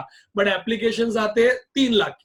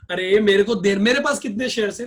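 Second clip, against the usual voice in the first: usual speaking voice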